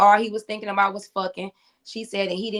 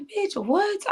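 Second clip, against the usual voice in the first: shocked disbelief; 11 semitones higher than usual. Retold or imitated. Retold shocked reaction.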